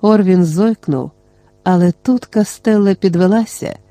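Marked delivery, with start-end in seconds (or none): none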